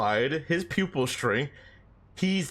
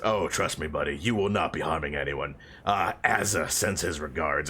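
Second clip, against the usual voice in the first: a light mobster imp, lower and smug; 9 semitones lower than usual. Retold or imitated. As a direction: deliver the threat like a cool hitman, low. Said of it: "and he says".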